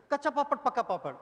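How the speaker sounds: quick, punchy Kacha papad, pakka papad; fast twister demo. Shown as a demonstration. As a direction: snap it out quickly. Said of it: "it should be told like this".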